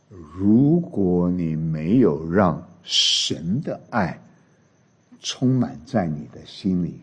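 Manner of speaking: low, slow, measured exhortation; solemn preaching. Real, not acted. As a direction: weigh each phrase with a grave, hushed earnestness. 牧师郑重劝勉会众. (低沉缓慢、语重心长地讲道)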